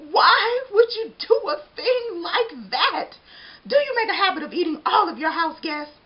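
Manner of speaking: loud, high, indignant demand; outraged. Acted. Donut outraged at eater.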